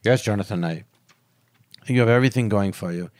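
low-pitched professor voice; low, measured acted professor's verdict; imitating a professor. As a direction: play the old teacher, pitched well down. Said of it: "He goes".

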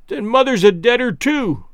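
put-on high, loud little girl's cry of grief; a child learning her mother is dead. A narrator's reading. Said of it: "cried the little girl".

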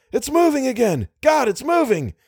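high-pitched panicky exclamation; alarmed cry. A narrator's reading. Driver sees the monster move, crying out alarmed.

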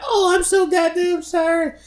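very high, slow, put-on sorry voice; exaggerated mock apology. Mimicking the clerk apologizing again, slow squeaky mockery. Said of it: "She's like".

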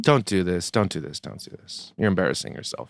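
low, hushed plea; quiet disapproving aside; low admonishing. Real, not acted. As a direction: drop it to a murmur, fading away.

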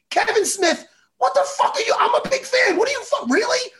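high, disbelieving outburst; incredulous outrage. Real, not acted. Fan yelling at Kevin Smith, high incredulous outrage. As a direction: call him out in disbelief.

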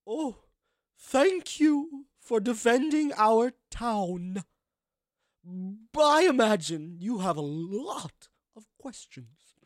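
grand, deliberate thanks, words stretched out; stately townswoman. Acted. Woman thanking the town's defenders, stately and slow. Game master voices townswoman.

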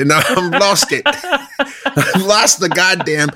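high, laughing lament. Real, not acted. Host loses his accent mid-bit, high and laughing. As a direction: crack up while protesting the loss.

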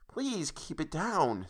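slow, soft mild hush, half-voiced; a lightly voiced grandmother urging quiet. A narrator's reading. Grandmother hushing late-night quarrel.

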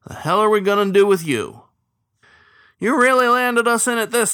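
loud, high, exasperated scold; an old cook berating his captain; gruff scolding. A narrator's reading. Old cook scolds the captain, loud and exasperated.

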